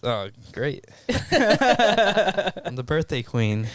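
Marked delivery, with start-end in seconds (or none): 1.1-3.7 sarcastic drawl. Real, not acted. Reading the sash, amused.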